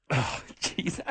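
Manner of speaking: breathy whispered sigh; exasperated whisper. Real, not acted. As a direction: mutter it under your breath.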